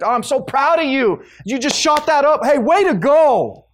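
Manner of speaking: mocking the people who congratulate an addict; mock cheering praise. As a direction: gush over the addict with fake pride, scornfully. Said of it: "that's like telling the drug addict".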